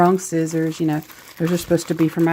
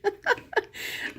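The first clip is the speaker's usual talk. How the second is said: high, soft giggle at herself; helpless little high-pitched laugh; embarrassed laugh; 7 dB quieter than usual. Real, not acted. Vlogger spilled the pens on herself, embarrassed laugh.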